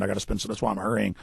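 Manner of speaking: usual speaking voice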